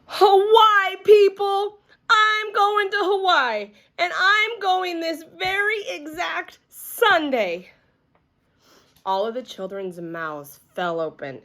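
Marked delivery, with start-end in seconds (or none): 0.1-7.7 hollering. A narrator's reading. Junie B hollers her big news to the class. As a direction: shout the news out at the top of your lungs. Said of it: "the words came hollering out".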